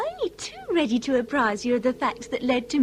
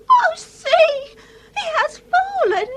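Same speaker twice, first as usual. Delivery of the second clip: high, loud cry of alarm; a daughter seeing her father fall, crying out; shocked cry; 12 semitones higher, 5 dB louder than usual. Acted. Crying out as her father collapses.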